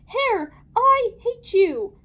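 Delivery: high, slow, loud yell; lady's yell. A narrator's reading. Lady tells off the hair on her tummy, yelling. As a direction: yell it out, stretching each word. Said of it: "The lady yelled".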